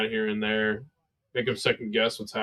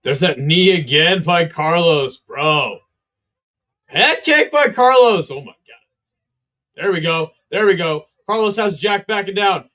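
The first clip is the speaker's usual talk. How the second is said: higher, louder, rapid fight calls; excited play-by-play; 7 semitones higher, 5 dB louder than usual. Real, not acted. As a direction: call the flurry as it happens. Live fight flurry commentary.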